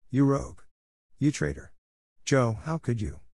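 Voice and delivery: crying out. A narrator's reading. Three girls to the friend who tricked them, mildly crying out. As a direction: cry out accusingly without much volume. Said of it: "cried the three girls".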